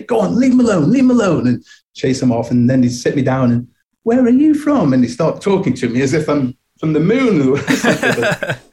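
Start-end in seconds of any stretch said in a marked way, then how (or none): 0.0-1.5 put-on high, loud shooing old-lady voice; scolding old lady. Headscarfed lady chasing bullies from her door, loud high scold. 4.0-4.9 put-on high, louder old-lady question; curious old lady. Rescuing lady questioning the scared boy, high loud query. Ladies asking after rescuing him.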